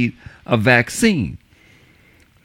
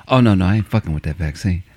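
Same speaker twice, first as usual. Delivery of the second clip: lower, dismissive buddy voice; buddy refusing; 9 semitones lower than usual. Retold or imitated. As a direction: wave it off low like the buddy.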